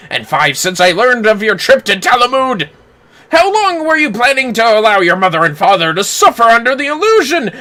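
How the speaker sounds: a hint of a theatrical reproach; voicing the father's dramatic rebuke; stern father scolding. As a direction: lecture the runaway son with mock gravity. Voices scolding father character.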